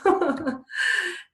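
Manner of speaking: high burst of real laughter; amused laugh. Real, not acted. Teacher warning students about holey socks, laughing. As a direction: laugh at your own little warning.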